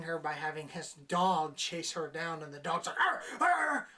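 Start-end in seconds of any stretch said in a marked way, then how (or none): 3.0-3.9 high, loud mock dog barking; aggressive dog bark. Reviewer imitating the dog chasing her, aggressive barks. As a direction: bark it out like an angry guard dog. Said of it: "all aggressive".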